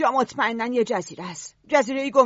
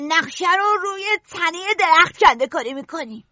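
excited; 5 semitones higher than usual. Acted. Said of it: "سیمون هیجان زده شد".

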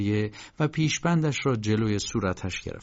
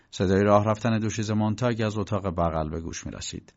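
plain reading voice

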